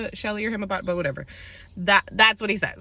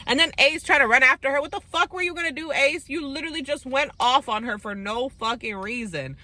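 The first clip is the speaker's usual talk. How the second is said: outraged rant; 6 semitones higher than usual. Real, not acted. Vlogger calling Ace out for chasing her, outraged. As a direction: fire it straight at him, incredulous.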